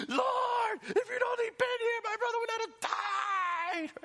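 screaming high and loud, crying through it; K-drama wailing. Pretend. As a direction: wail it like a sobbing soap heroine. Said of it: "Martha in tears with snot and screaming like she's in a K-drama".

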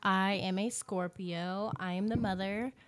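normal talking voice

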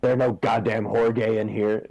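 lightly voiced grumpy old man; a hint of a crusty old vet barking roll; old sub teacher. Old substitute teacher scolding the class, mild gruff voice. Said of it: "he's like".

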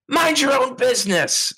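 sharp high yell at him to back off; loud female shout. Acted. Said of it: "loud female voice go".

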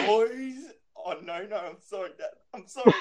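put-on high-pitched kid's frantic apology; mock panicked pleading. Boys to their fallen dad, mock panicked pleading. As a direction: beg in an exaggerated high voice, breaking into a laugh.

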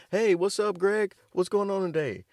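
high, loud friendly hail; cheerful shouted hello to Greg down the hall; cheery greeting. Retold or imitated. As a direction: call out brightly to the roommate. Greeting roommate Greg in a story.

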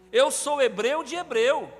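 proud boast. Retold or imitated. Voicing Paul's boast.

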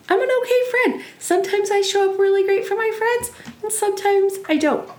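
high, breezy self-acceptance; bright upbeat. Real, not acted. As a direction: lift the voice way up, light. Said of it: "upbeat affirmation".

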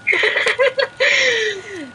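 high, drawn-out laugh; long squealing laugh; laughter. Real, not acted. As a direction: burst into high-pitched laughter. Laughing at friend's excuse.

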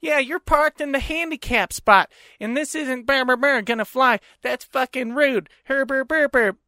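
high, nagging imitation trailing into babble; mocking her voice. Man mimics woman going off on him, high mocking nag. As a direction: mimic her rant, dissolving into nonsense noises. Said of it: "She sounded just like that fucking dude".

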